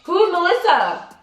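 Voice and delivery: high-pitched mock pleading of a caught boyfriend; imitated panicked protest. Imitating boyfriend asked about Melissa, panicked protest. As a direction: play his flustered denial, voice jumping up.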